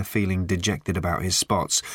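usual speaking voice

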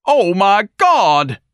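high, loud, drawn-out outcry; bellowed. Acted. Ignatius bellowing at the stage act, high and loud. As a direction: bellow it out, stretched long. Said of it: "Ignatius bellowed".

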